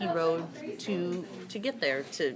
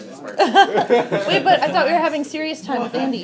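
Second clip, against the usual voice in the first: laughing; 7 semitones higher, 6 dB louder than usual. Real, not acted. Laughing protest.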